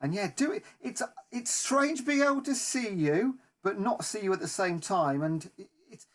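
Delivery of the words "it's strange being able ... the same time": ordinary delivery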